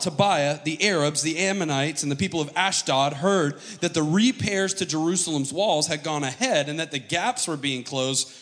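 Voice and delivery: usual speaking voice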